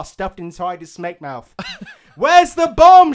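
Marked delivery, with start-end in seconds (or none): 2.2-3.1 high, loud interrogating shout. Acted. Maiden interrogating Venomancer, furious shout. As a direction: bellow it at the suspect. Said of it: "shouted Crystal Maiden".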